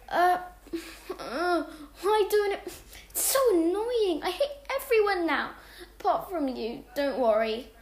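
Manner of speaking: high whiny moan with a laugh. Acted. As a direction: moan and complain, half laughing. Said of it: "I moaned to Jess".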